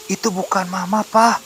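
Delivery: put-on high little boy voice; high-pitched, pleading child; child's voice. A narrator's reading. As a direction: protest to dad as a small child. Child corrects his father.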